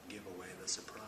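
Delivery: secretive whisper. Acted. Actor hushing a plot spoiler for the host, whispered.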